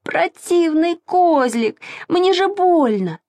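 high, whiny indignant complaint; pained cry. A narrator's reading. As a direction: wail crossly at the goat that hurt you, slowly. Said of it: "закричал Саша". (обиженно кричит высоким голосом)

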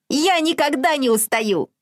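high, fast bragging piglet; boasting piglet. A narrator's reading. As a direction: brag like a cocky piglet.

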